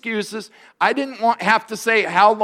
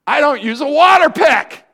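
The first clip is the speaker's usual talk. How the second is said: loud defiant retort; 8 semitones higher, 7 dB louder than usual. Retold or imitated. Pastor retelling defying his dentist, loud and high. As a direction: shout it out, defiant and pitched up. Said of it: "And I".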